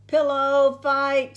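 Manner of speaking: high, loud playful shout; big high-pitched holler; excited scream. A narrator's reading. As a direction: yell it out like a boy starting a battle. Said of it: "screamed Buster".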